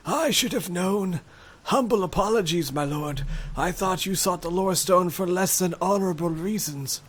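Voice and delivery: put-on high, humbled old voice; awed old man apologising; shaky old voice. Stunned magician apologises to hero.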